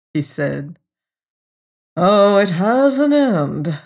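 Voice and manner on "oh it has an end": slow, louder, raised-pitch grimness; somewhat grim. A narrator's reading. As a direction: draw it out with mild foreboding. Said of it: "said somewhat grimly".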